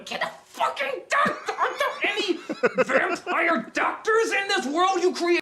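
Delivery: hammed-up exasperated cry; mock yell. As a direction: yell it in disbelief through laughter. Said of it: "retold outburst".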